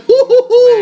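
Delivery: high, loud whoop; hammed-up race fan whoop; cheering woo. Cheering for the number eight car.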